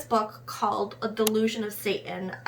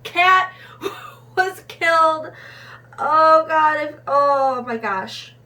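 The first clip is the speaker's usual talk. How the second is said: crying out the words as it overwhelms her; choked-up crying; 6 semitones higher, 8 dB louder than usual. Real, not acted. Reviewer telling viewers the cat was killed, crying. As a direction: voice breaking into real tears, louder and higher.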